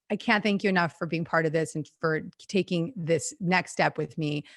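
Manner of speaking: ordinary delivery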